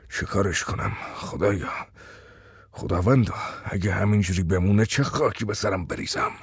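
low, soft hopeless moan; a father at his wits' end; despairing lament.

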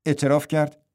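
usual speaking voice

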